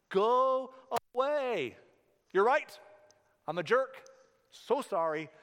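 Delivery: high and loud, sending him off; annoyed shout. Acted. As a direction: shout through the door to go away. Neighbour shouts him away.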